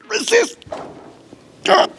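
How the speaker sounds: resist jumps way up in a squeak; squeaky high resist. Acted. Pushing down on volunteer's arm.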